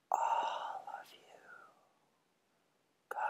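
voiceless whisper fading away; gusting windy whisper. Acted. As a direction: whisper like wind rising from below. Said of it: "whispered the wind coming up".

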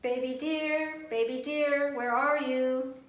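loud, high calls while searching; calling out. Acted. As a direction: call across the snow for the lost fawn. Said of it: "the animals cried as they searched".

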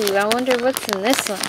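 ordinary delivery

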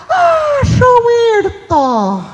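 very high, slow drawn-out cooing of the name; fond coo. Retold or imitated. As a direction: stretch the name out lovingly, way up high.

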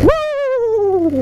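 high gleeful whoop; excited whoop. Real, not acted. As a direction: whoop it over the bump. Going over hill bump.

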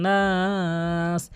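নাস with the a stretched for three alifs; long Naas. Shown as a demonstration. Teacher showing the stretched vowel, drawn out. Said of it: "তিন আলিফ টেনে পড়তে হবে".

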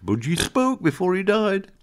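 high, slow put-on customer voice; a wide-eyed punter telling the tale; pet shop customer. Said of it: "And he said".

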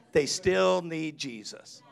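slowed right down, still leaned on; slow emphasis. Shown as a demonstration. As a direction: let each word land slowly. Repeated for emphasis.

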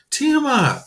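high loud horrified cry; boy crying out for his hurt dragon; cry of horror. Acted. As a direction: call her name in panic. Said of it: "he cried in horror".

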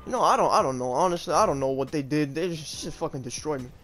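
ordinary delivery

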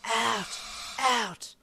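loud, high, hissing creature cry; hissed. A narrator's reading. Boxed creature demands release from the doctor, hissing loudly. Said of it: "the voice hissed".

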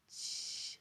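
hushed, whispered shush. A narrator's reading. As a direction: whisper it to hush the listener. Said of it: "szepnął". (szeptem, uciszająco)

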